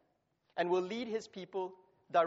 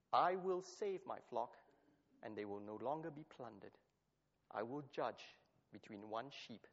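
solemn reading sinking softer and lower; scripture reading, fading; 6 semitones lower, 6 dB quieter than usual. Acted. Preacher reading God's judgment, softer and lower. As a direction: let the Lord's words settle down quietly.

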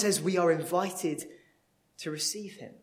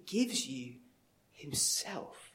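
whisper; 3 semitones lower, 5 dB quieter, slower than usual. Real, not acted. Sermon climax softened.

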